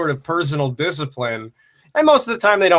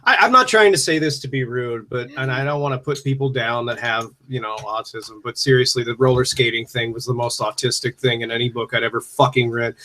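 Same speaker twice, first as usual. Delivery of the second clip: usual speaking voice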